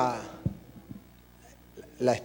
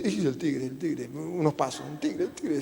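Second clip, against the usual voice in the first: much softer, scared little murmurs; trembling fright; 4 semitones lower, 11 dB quieter than usual. Acted. Lost man hears footsteps in the dark, hushed frightened murmur. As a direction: shrink in fear at the footsteps. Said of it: "se pone todo tembloso".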